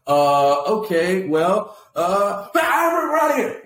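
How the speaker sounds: stalling uhs, then sudden high, loud bolt; drawn-out hesitation bursting into a comic yell; mock panicked escape. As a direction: imitate someone cornered who suddenly makes a run for it. Acting out skit character fleeing grilling.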